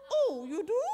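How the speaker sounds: mock-alarmed little frog, laughing; startled frog. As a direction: frog suddenly gets it and backpedals. Frog realizes it will be eaten.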